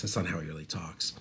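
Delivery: ordinary delivery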